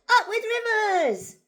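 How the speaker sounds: high, loud, drawn-out cheer; hooting. A narrator's reading. Narrator voices duckbills delighted by the river, hooting. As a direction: hoot it like a happy chant. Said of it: "And they hooted".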